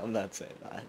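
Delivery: soft giggling no; sheepish laughing refusal. Real, not acted.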